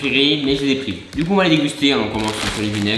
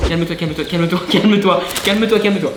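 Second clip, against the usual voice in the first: growing frantic calming; 5 semitones higher than usual. Real, not acted. Chick slipping on chip bags.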